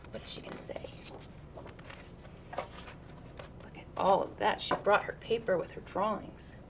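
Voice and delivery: put-on hushed, breathless whisper about the nervous girl; whispered aside. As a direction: lean in and whisper, as if not to disturb her.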